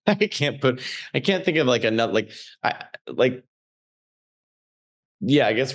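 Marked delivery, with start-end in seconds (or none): none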